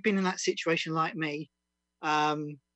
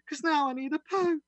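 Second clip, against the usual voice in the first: punchline lift; 10 semitones higher, 4 dB louder than usual. Acted. Punchline of comic poem.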